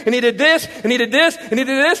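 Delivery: ordinary delivery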